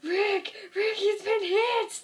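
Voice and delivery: high, slow alarmed cry; mock panicked yell. Shane yelling as Rick is shot, hammed-up high alarm. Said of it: "Shane's like".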